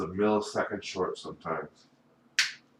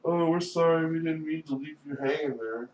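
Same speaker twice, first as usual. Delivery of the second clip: high-pitched put-on apology; parroting an apology, pitched up; mock apology; 8 semitones higher than usual. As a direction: mimic their excuse in a raised voice. Mimics relatives' excuse to him.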